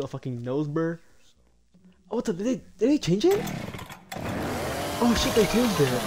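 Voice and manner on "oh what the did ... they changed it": surprised. Real, not acted. Surprised the map changed.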